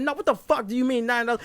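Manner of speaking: high-pitched indignant challenge; retold outrage, demand an explanation; mad. Customer challenging the cashier over nine dollars, mad. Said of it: "I was mad as shit".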